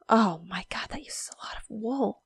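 hushed whispered aside; whispering. Real, not acted. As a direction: whisper it to yourself in dismay. Aghast aside at wool cost.

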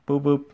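high train toots; imitated engine whistle. A narrator's reading. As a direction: toot to signal departure. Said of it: "he whistled".

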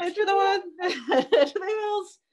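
high, playful fake panic; hammed-up alarm with a laugh breaking in; mock terror. Zoo keeper pretending to flee tiny bats, mock terror. Sarcastic show of scary bats.

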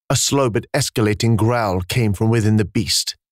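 ordinary delivery, nothing marked

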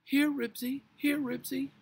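loud, very high calling; high dog call. A narrator's reading. Missus Grumby trying to call Ribsy back, high call.

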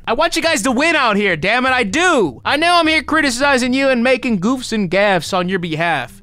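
high-pitched, emphatic cheering-on; root for them with the voice pushed up; impassioned plea. Real, not acted. Impassioned appeal to video makers.

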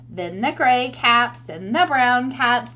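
plain reading voice

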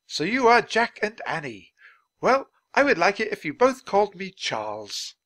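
high, animated gentleman; genial Dickens. Acted. Dickens invites Jack and Annie to call him Charles, genial. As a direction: lift the voice into a hearty introduction.

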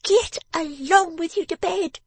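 very high, loud, cross command; snapping. A narrator's reading. Miss Wren interrupts her father's excuse, snapping. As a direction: cut him off in a high scolding voice. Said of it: "snapping him up".

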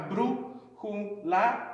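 "brújula" slowly pulled apart into syllables; slow teaching breakdown of "brújula". Shown as a demonstration. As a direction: sound out "brújula" one piece at a time. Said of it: "when you say the word all the way through".

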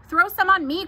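put-on high, loud boyish demand; eager cry. A narrator's reading. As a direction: call out eagerly, pitched right up. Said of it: "cried Avery".

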